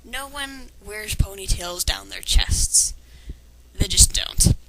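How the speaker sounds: ordinary talk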